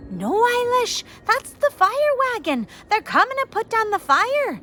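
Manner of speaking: high, loud reassuring call; loud urgent big-sister call. Acted. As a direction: shout to calm your sister over the noise. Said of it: "Fiona called out".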